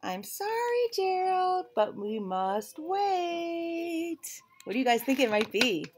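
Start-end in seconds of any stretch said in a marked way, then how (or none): 0.0-4.4 high, slow, sweet pig voice; Piggy voice. Acted. Piggy tells her friend Gerald about a surprise, high and teasing. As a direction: play Piggy, teasing with a secret.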